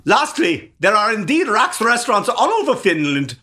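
high, hammed-up Austrian accent. Shown as a demonstration. Podcaster demonstrates his Austrian to cohost, exaggerated Austrian accent. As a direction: announce it in a bad Austrian accent. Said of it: "This is my Austrian".

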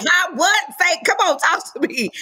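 high, insistent comic pestering; mock can't-hear-you. Comedian to near-silent castmates, high-pitched and exasperated. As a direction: jump up high, begging them to speak up.

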